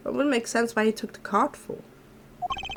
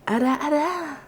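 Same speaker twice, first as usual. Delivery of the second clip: ara ara tease; 7 semitones higher than usual. Shown as a demonstration. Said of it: "I'm not sure if I can good ara aras".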